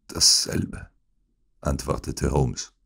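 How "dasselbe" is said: breathy sickbed reply; feeble whisper. Acted. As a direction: answer like a dying man. Sick Holmes answers feebly. (schwach gehaucht)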